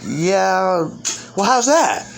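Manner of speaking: high-pitched mock puzzlement; acted questioning reply. Acted reply in retold exchange.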